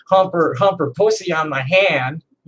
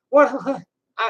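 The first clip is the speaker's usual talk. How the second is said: breaking into tears; 7 semitones higher than usual. Real, not acted. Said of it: "I'm not crying".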